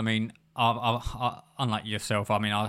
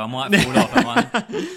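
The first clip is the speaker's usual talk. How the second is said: laughing joke; 10 semitones higher, 9 dB louder, slower than usual. Real, not acted. Joking about falling off chair.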